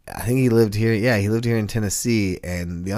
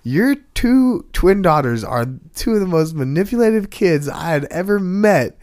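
heated retort; 8 semitones higher, 6 dB louder than usual. Retold or imitated. Confronting mother over lying daughters.

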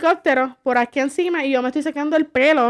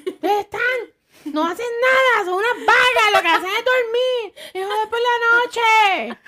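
higher pitched mock nagging mother; mock scolding mother; 7 semitones higher than usual. Mother nagging her daughters, higher mock scolding. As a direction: nag the lazy girls, half laughing.

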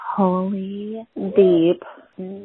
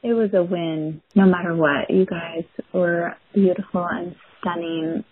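ordinary delivery

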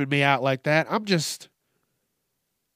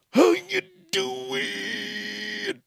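stretched greeting; much slower than usual. Acted. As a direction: float the last take up high. Drawn-out host greeting repeated.

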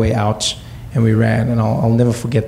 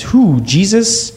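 surprised; 8 semitones higher, much slower than usual. Retold or imitated. Kid confused about marrying.

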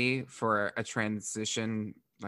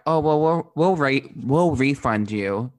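mock airline agent; 3 semitones higher, 8 dB louder, slower than usual. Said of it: "were like".

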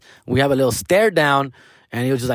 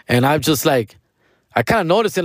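normal talking voice

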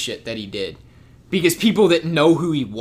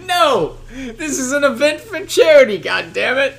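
Seth Rogen yelling; 10 semitones higher, 4 dB louder than usual. Retold or imitated. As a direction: berate the crowd, pitched way up. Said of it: "He's like".

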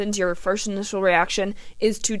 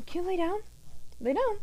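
high, softer coaxing pet talk; dog voice; 10 semitones higher, 11 dB quieter than usual. Real, not acted. Host asking her panting dog to lay down, dog voice. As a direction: coax a dog softly in a high little voice. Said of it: "having to hear my dog voice".

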